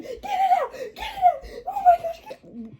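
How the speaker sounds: very high, squealed imitation of panic; mock panic. Classmate whipping braids over a wasp, high mock panic. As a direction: squeal like a frantic pranked friend, half laughing.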